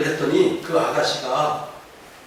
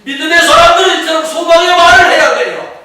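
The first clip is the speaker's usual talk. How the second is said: shouted high and loud exhortation; preaching shout; 12 semitones higher, 12 dB louder than usual. Real, not acted. Pastor exhorting believers, shouting high and loud. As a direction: drive it home at the top of the voice.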